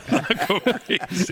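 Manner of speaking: high, laughing echo. Real, not acted. Co-host echoing the joke to his pals, laughing. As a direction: repeat it through real laughter.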